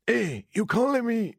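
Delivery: high, slow mock-Mexican challenge; attempted Mexican accent. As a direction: try out a hammed-up Mexican accent. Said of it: "can't do the Mexican accent".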